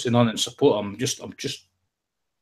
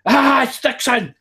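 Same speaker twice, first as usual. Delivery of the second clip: high, drawn-out comic scream of warning; mock alarm cry; 13 semitones higher, 6 dB louder, slower than usual. Kid warning mates the farmer is coming, high mock-panicked yell. Kids spot the farmer chasing.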